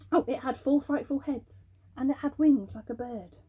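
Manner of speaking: plain reading voice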